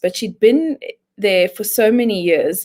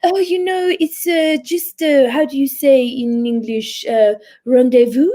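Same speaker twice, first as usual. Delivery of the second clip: put-on higher, pretentious French-accented English; fishing for a French word to sound posh; mock French accent, pitched up and hesitant; French-tinged English accent; 5 semitones higher than usual. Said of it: "with a French accent when she speaks English".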